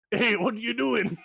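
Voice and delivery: high, loud startled squeak; startled goblin squeak. Acted. GM voicing goblin caught and lifted up, startled and high. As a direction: yelp it like a caught critter.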